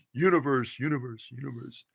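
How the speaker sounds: a homemade reverb tail dying away; echo voice. Shown as a demonstration. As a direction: universe repeated as an echo that fades out. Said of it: "I want to do the echo voice".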